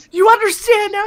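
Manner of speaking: high mock scolding. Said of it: "I was like".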